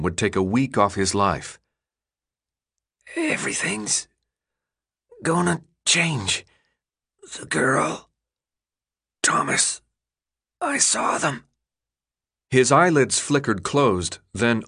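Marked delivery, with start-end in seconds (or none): 3.2-11.4 high, strained wheeze; a sick boy wheezing a warning. A narrator's reading. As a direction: force each word out weakly. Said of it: "came out in a wheeze".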